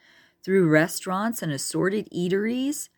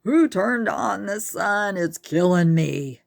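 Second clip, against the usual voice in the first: slow, pained hungover groan, louder; complaining hyena; 4 semitones higher, 6 dB louder than usual. A narrator's reading. Hairy Hyena complaining about the bright morning sun, pained loud moan. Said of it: "complained Hairy Hyena".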